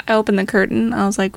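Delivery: ordinary talk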